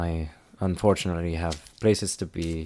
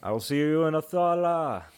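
calling after someone; 9 semitones higher than usual. Acted. Traveller to boy leaving for the road, calling out. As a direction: sing it out into the distance. Calls after the departing wagon.

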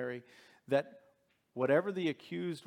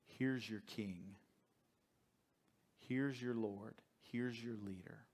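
softer, slow, weighty reading; hushed slow presentation; 4 semitones lower, 10 dB quieter, slower than usual. A narrator's reading. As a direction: let each phrase land quietly.

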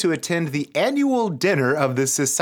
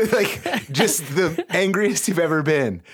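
laughing; 5 semitones higher, slower than usual. Real, not acted. Cracking up while reading.

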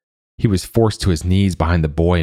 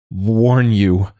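higher and louder, forced out in a sputter; spluttered; 5 semitones higher, 4 dB louder than usual. A narrator's reading. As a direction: choke the words out, straining and louder. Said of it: "Joa spluttered again".

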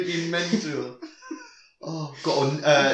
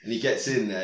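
usual speaking voice

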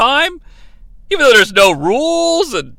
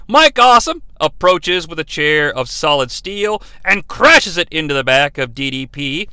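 ordinary delivery

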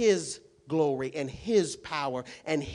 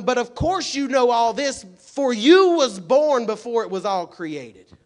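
sarcastic; 10 semitones higher, 15 dB louder than usual. Acted. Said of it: "Being sarcastic".

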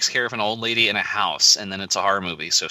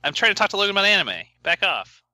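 irritated; 10 semitones higher, faster than usual. Acted. Snapping at a co-host.

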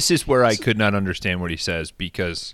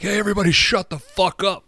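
yelling; 11 semitones higher than usual. Retold or imitated. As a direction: shout the room down. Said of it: "And then Mudfish is like".

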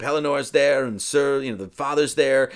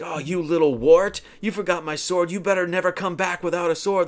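higher, louder bullying rebuke; mock scolding; 5 semitones higher, 3 dB louder than usual. Kay berating Arthur over the sword, mock scolding. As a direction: play an older brother telling off a boy.

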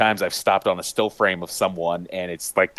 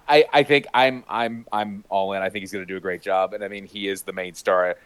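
ordinary delivery

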